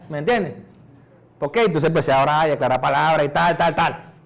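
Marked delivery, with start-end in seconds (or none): none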